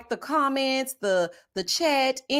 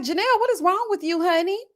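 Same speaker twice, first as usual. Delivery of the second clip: mock scolding; 8 semitones higher than usual.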